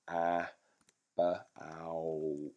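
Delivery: Ah, ba, ow sounded slowly piece by piece; sounding it out. Shown as a demonstration. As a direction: sound out each part while writing. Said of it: "if they can actually say".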